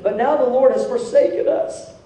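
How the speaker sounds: imitated whiny protest; mock whining. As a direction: pile up the grievance in a high mock whine. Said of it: "he's just whining".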